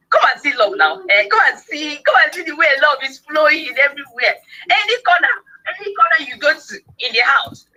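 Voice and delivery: high-pitched, exuberant exclaiming; delighted, animated boasting about love; gushing excitement. Real, not acted. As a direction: call everyone over to marvel, pitch soaring.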